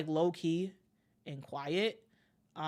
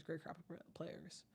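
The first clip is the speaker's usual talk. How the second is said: much softer, slow repeat, almost to himself; trailing off; 19 dB quieter, slower than usual. Real, not acted. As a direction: let it fade away to nearly nothing. Repeats phrase, second trails off.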